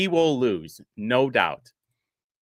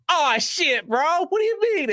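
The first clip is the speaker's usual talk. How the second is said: a shrill-free squeaky high put-on voice climbing higher; mock his startled reaction, pitching way up; high-pitched mock disbelief; high mocking quote; 13 semitones higher than usual.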